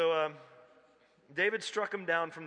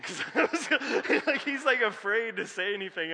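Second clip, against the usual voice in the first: laughing; 9 semitones higher, 10 dB louder than usual. Real, not acted. Laughing while telling the punchline.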